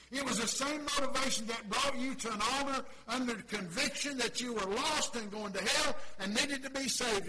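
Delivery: testify, climbing to a cry of glory; preaching at full force. Acted. Preacher shouting his own salvation to the congregation.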